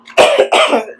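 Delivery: a real cough fit; rough chesty cough. Real, not acted. Coughing during run.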